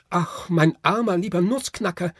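put-on high, loud girl's cry of dismay. A narrator's reading. Marie cries out loud over her broken Nutcracker. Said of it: "schrie Marie laut".

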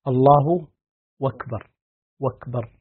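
"أكبر" said as "wakbar", w replacing the glottal stop; hamza as waw. Shown as a demonstration. Sheikh showing students a dialect, "أكبر" pronounced "wakbar". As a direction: glide from الله straight into "wakbar". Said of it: "يقلبون الهمزة واوا".

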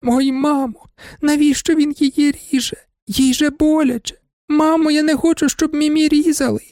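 put-on very high, sustained childish wail; tearful child crying out. A narrator's reading. Little girl pleads with mother, wailing very high. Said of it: "закричала".

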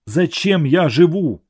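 loud, high despairing cry. A narrator's reading. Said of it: "громко сказал он".

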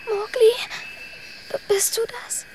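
frightened, stammering whisper; calling out in fear; scared whisper. Acted. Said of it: "zittert vor Angst".

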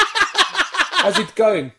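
put-on slow, high South African accent. Comedian showing off a South African man, exaggerated accent. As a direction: draw out a hammed-up South African greeting. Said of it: "I can do a South African man".

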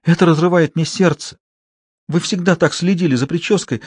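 milk the parting scene; tragic actress. Acted. Actress Klara plays a farewell to her husband, high and theatrical.

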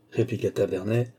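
ordinary delivery, nothing marked

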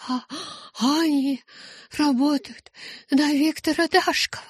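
high, breathless rush of words; Rose, just back from the vision, gasps out the news, high; gasping. A narrator's reading. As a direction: get it out between gasps. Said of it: "задыхаясь".

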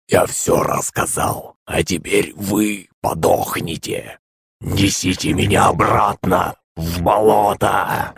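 slow, soft, otherworldly creature voice; eerie monster chorus. A narrator's reading. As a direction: command like many voices at once. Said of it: "Закричало несколько голосов в голове".

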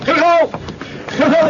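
calling out. Acted. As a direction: shout to catch his attention. Reporter chasing the general.

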